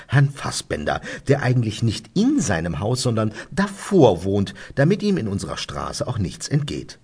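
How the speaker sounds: ordinary delivery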